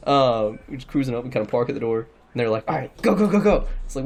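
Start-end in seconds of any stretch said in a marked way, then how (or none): 2.7-3.6 mock hurried go-go-go, slightly higher; urgent urging. Friends hustling the getaway driver, retold urgency. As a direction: urge the driver along, retold.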